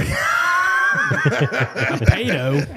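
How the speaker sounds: slow, stretched laughing repeat of pedo; incredulous laugh. Real, not acted. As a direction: drag out A pedo while cracking up. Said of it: "must be that Canadian accent".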